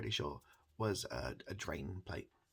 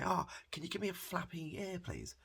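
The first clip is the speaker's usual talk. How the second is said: imitating kid; 11 semitones higher than usual. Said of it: "and they say".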